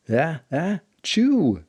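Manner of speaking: big high sneeze building up; ah-ah then burst out the chew; acted sneeze. Sage sneezing under the floating wall, hammed-up sneeze.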